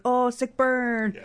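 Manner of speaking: high, drawn-out jeering reaction; exaggerated playground-style ooh; mock crowd taunt. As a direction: stretch it out like a teasing crowd. Voicing everyone reacting to the burn.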